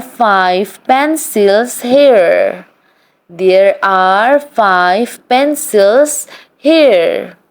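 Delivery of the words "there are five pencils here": ordinary talk, nothing marked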